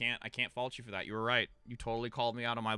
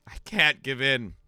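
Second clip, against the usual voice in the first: slow, slightly louder refusal; mock resolve; 5 dB louder, slower than usual. Exhausted streamer refusing to yield to sleep, mock resolve. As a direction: hold out against tiredness, measured. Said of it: "and I'm like".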